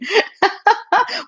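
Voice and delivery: short high giggle; real amused laugh. Real, not acted. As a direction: laugh brightly at the comment. Laughs at viewer comment.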